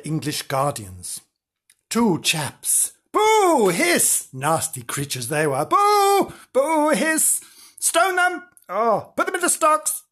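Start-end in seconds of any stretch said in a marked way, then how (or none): 3.1-4.3 high, loud panto boo and hiss; hammed-up boo and hiss; mock pantomime booing. 5.6-10.0 high, loud panto boo and hiss; hammed-up boo and hiss; mock pantomime booing.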